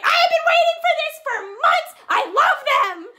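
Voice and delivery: high, loud fangirl scream; ecstatic scream. Retold or imitated. Fan screaming in a stranger's face at the concert, ecstatic. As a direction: scream it full out, pitch shooting up. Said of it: "just screamed in her face".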